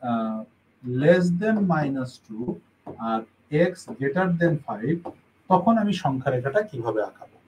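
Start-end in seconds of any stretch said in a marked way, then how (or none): 0.1-5.0 very slow and careful; slow read-out. Shown as a demonstration. Teacher reads an example inequality to students, slowly. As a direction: read each word slowly. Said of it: "আর যদি এরকম আসে যে".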